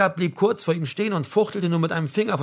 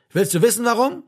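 raised voice, pitched up; agitated challenge; 8 semitones higher, 6 dB louder than usual. A narrator's reading. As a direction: demand it heatedly, short of a full shout. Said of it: "wiederholte er und wurde lauter dabei".

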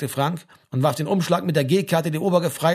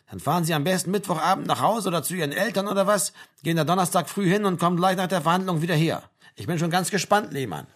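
talkative army sergeant; 4 semitones higher than usual. Acted. Sergeant sends soldier to his hearing, amused. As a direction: hand out instructions to a conscript.

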